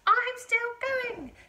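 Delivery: high, loud, slow granny screech; old screechy voice. A narrator's reading. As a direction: screech it down from above. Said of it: "came the old screechy voice".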